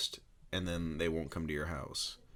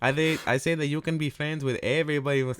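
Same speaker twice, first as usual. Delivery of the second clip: higher, louder, laughing parody; mocking imitation; 8 semitones higher, 11 dB louder, faster than usual. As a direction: keep overdoing the friend's preachy tone. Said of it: "That's how you talk".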